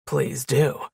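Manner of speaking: low, smooth man's voice with menace; smooth, deep, menacing. A narrator's reading. Man answering her death threat, smooth deep menace. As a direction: drop deep and invite it smoothly. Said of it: "His voice was smooth, deep, menacing".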